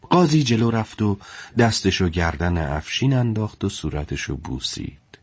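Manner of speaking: ordinary delivery, nothing marked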